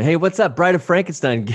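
high, loud jeering call; loud mock greeting. Buddy hails the giant stranger, loud high jeer.

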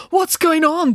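high, loud alarmed demand; acted outburst of indignation. A narrator's reading. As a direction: demand an answer, pitched right up. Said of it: "demanded Dad".

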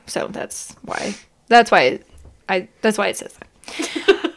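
trailing aside under laughter; whispered aside. Real, not acted. Explaining the Greg joke to viewers, faded aside.

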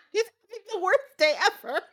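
high, fast, through giggles; squeaky amused disbelief; laughing. Real, not acted. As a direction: crack up while lamenting it. Laughing at the boy's bad luck.

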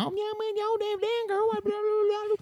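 high, mocking imitation of Laurel's accent. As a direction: ham up Laurel's accent in a squeaky voice. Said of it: "She talks how she talks".

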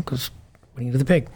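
ordinary delivery

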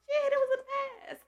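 high, loud laugh through tears; real crying laughter; tearful laugh. Real, not acted.

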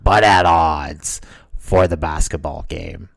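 DJ-style promo hype, dropping in pitch at the end; [name]. Acted. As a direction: wind up the match promo like a wrestling announcer. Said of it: "his D J voice".

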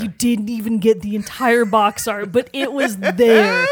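loud, stretched-out, laughing jubilation; overjoyed fan belting out the big news; real excitement. Real, not acted. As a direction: build to a big, slow, triumphant reveal. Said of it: "I could sense your excitement".

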